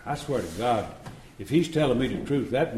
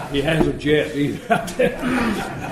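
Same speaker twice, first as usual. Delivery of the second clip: laughing; 4 semitones higher, 4 dB louder, much slower than usual. Real, not acted. Laughing at his own joke.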